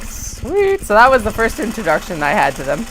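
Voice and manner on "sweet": squeaky high excited "Sweet."; mock delighted. Hearing about free beer, high happy squeal. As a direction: react with sudden glee. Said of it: "I'm like".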